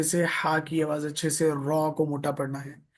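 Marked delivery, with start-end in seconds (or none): none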